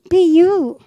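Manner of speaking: loud, high, ringing "Be you"; exhorting shout. Real, not acted. Preacher to her congregation, a loud rallying cry. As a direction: call it out to the whole room.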